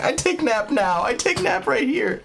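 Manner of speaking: put-on high, slow, babyish toddler voice; upset little girl. Upset toddler at the beach refusing to move, high slow whine. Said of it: "She's like".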